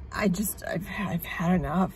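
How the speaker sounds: whispered, drained and defeated; weary whisper. Real, not acted. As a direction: breathe it out, completely spent. Exhausted quiet admission.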